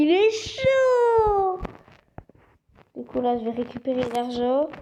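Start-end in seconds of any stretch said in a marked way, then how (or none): 0.0-1.6 high, loud, stretched-out cry; excited exclamation. Real, not acted. Gamer thrilled by his in-game bottle, loud drawn-out cheer. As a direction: draw it out in delight.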